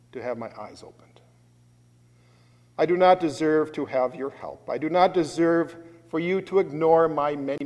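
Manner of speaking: pleading, pressing on not; humble plea for mercy. Shown as a demonstration. Preacher voicing a humble sinner begging Jesus, pleading confession. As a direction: confess you deserve nothing. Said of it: "a cry for mercy is just the opposite".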